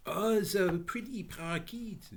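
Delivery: put-on high, French-accented lady's welcome. A narrator's reading. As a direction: greet them in a lilting French accent. French lady speaks.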